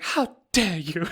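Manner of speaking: slow, breaking mock sob; mock wail of outrage. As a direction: ham up a wounded cry. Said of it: "That's like".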